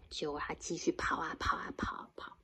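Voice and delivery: breathy whispered narration; soft unvoiced storytelling whisper. A narrator's reading. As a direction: hush it like a secret.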